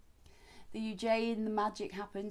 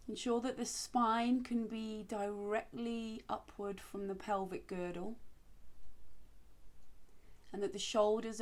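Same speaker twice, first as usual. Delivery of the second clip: guided meditation; 5 dB quieter than usual. Acted. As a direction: ease them into stillness gently. Leads seated breathing practice.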